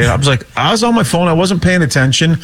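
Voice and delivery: ordinary talk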